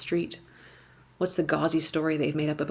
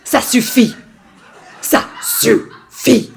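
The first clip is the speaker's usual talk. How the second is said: put-on loud, higher, demon-like scream at the kids; growly French scream; 6 semitones higher, 12 dB louder, much slower than usual. Mother losing it, screaming at her kids in French. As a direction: summon the dark voice and roar it. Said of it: "my voice goes low and growly".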